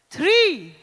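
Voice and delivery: high, loud countdown with yells; hyped launch countdown; shouted countdown. Acted. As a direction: build the room to the laugh. Countdown to group laugh.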